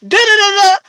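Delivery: hammed-up angry husband; husband slamming it down. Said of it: "slammed that shit on the table and said".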